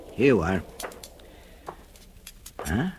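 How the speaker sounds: ordinary delivery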